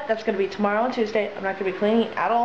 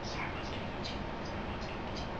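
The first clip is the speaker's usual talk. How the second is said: soft breathy whisper, no voice; 17 dB quieter, much faster than usual. Real, not acted. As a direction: whisper it apologetically. Whispered apology, repeated.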